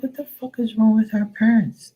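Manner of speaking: pitched high in mock disbelief; incredulous outburst. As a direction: react like you can't believe what they're doing. Retelling his shocked reaction.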